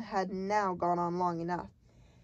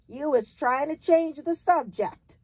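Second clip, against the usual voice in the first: higher, louder stern giant; sternly raised voice; 6 semitones higher, 6 dB louder than usual. A narrator's reading. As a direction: scold firmly as the giant. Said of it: "the giant said sternly".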